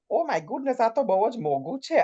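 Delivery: a hint of a grand lady exclaiming; imitating her stage character at the shops; posh Mrs Kapoor voice. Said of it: "Why the hell have I just said it like this?".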